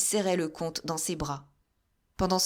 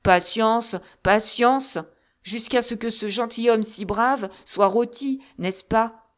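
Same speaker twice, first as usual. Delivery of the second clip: loud, higher, sarcastic echo; bitter mockery; 3 semitones higher, 6 dB louder than usual. A narrator's reading. Musketeer mocking Monk's calm, loud and bitter. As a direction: throw his words back at him. D'Artagnan mocks Monk's patience.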